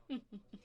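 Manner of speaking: short, soft coy laugh; flirtatious chuckle. Acted. Hostess flirting with guest after comparing them to dessert, coy chuckle. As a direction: chuckle coyly at your guest.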